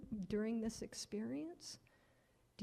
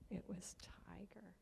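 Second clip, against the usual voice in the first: whispered reveal; 10 dB quieter than usual. Acted. Reveal to children.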